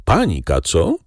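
ordinary delivery

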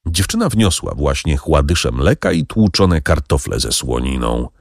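ordinary delivery, nothing marked

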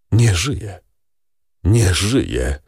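a touch of grief, each phrase left hanging alone; despairing sigh. A narrator's reading. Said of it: "westchnął Villefort w paroksyzmie rozpaczy". (westchnienie ze stonowaną rozpaczą)

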